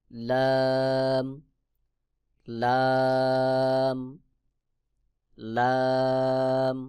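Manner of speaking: লাম held very long with the tongue edge on the gums; drawn-out letter demo. Shown as a demonstration. Teacher showing students the letter লাম. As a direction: stretch out লাম slowly. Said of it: "যেমন".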